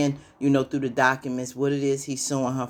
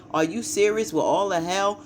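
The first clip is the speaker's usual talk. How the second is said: higher-pitched retold outrage; mock outrage; 6 semitones higher than usual. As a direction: act out her losing it, lightly. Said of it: "like, lost it".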